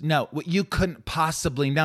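high, precise impression; Malkovich. Impressionist repeating a film clip, Malkovich impression. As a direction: pitch it up and pick out the words. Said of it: "I remember watching John Malkovich".

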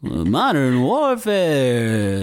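slow, high, bad Dylan drawl; [name] impression. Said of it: "That's a really bad [name]".

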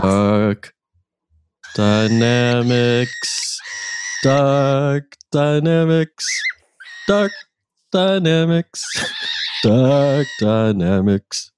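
put-on slow, drawn-out theme chant in a light British accent; amateur British accent, sung slowly as a jingle; British accent theme song. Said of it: "you broke into a British accent".

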